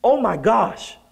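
high exclamation melting into an aw; stunned realization. Retold or imitated. Professor realizing they're first year students, acted high-pitched dismay. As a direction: gasp it out high, then coo in sympathy. Acts out sudden realization, sympathy.

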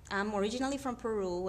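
ordinary talk

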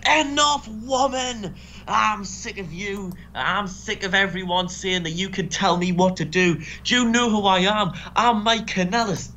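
high-pitched mock outburst, easing down towards the end; imitated shouting. Wrestler snapping at his wife in therapy, mock shouting. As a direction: act out a wrestler's fed-up rant. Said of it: "and eventually just shouts".

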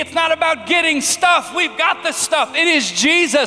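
high, loud, driving sermon voice; preaching at full force. Acted. Pastor urging Christians to occupy their street, preaching hard. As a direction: preach it out to the whole conference. Preaching peak after the story.

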